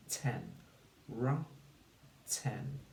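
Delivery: "Ra" and "Ten" as separate syllables, pauses between. Shown as a demonstration. Teacher blends the word for pupils, syllable by syllable. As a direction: give "Ten" a full short e, apart from "Ra". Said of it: "Say the sounds and build the word".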